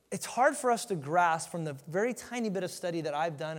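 ordinary delivery

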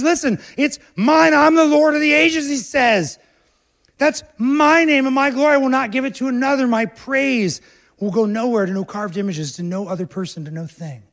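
high, loud proclamation that fades out; God declaring. Acted. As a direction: proclaim God's claim, then let it fall away. Voicing God claiming his glory.

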